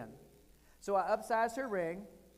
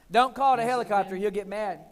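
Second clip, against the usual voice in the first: ordinary delivery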